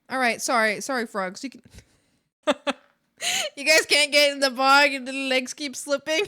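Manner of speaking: ordinary talk, nothing marked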